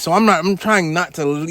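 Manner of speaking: normal talking voice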